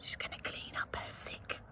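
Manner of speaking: quick hushed whisper to the listener; whispered aside. A narrator's reading. Reader confiding in the child listener, a fast whisper. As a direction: lean in and whisper it like a secret.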